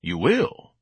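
rising, intrigued question; interested. Acted. Ignatius hears of daily bucks, suddenly interested. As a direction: perk up at the end. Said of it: "Ignatius asked with interest".

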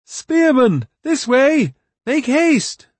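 high, loud battle shout; rally the troops at full voice. A narrator's reading. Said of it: "His words rang above the clash".

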